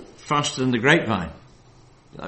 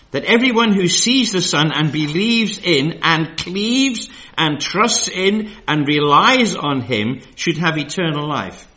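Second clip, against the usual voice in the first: slow, louder verse reading with weight; scripture reading; 4 semitones higher, 6 dB louder, slower than usual. Acted. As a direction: lean into each verb, slowly. Reads Amplified Bible aloud.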